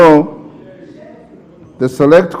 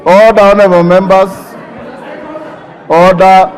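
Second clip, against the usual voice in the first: shouting order order; 3 semitones higher than usual. Real, not acted. Speaker interrupted, calls to order.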